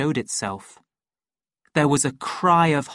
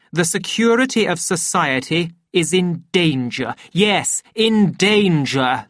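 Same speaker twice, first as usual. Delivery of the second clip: raised, emphatic public oratory; weighty formal accusation, key words punched; pompous director denouncing; 3 semitones higher, 4 dB louder than usual. Acted. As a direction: address the assembly with grave self-importance.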